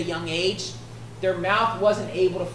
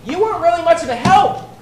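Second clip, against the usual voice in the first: loud, high, emphatic retort; raised-voice impersonation of an aggrieved fighter; Jephthah's indignant protest; 7 semitones higher, 9 dB louder than usual. Retold or imitated. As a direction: protest as the wronged leader, punching each grievance. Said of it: "what Jephthah is saying is".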